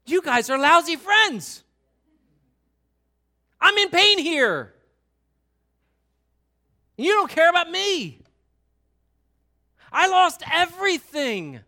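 high, loud hammed-up wailing protest; Job's anguished complaint. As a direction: cry out as suffering Job berating friends. Acting Job berating his friends.

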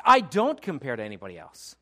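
emphatic, loud, assured declaration; total change of tone. Shown as a demonstration. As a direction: punch the opening, then let it drop. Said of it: "It's a total change of tone".

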